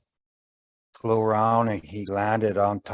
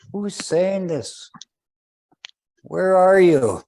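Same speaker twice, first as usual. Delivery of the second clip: high, loud searching call, slow at first; 8 semitones higher, 5 dB louder, slower than usual. Acted. Old man calling up to the unseen voice, loud and high. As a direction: call out to the hidden speaker.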